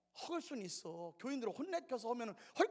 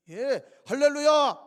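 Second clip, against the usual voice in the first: loud and drawn out, falling at the end; slow shouted hallelujah; 4 semitones higher, 19 dB louder, slower than usual. Real, not acted. Preacher calling out praise to the church. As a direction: stretch it out and shout it.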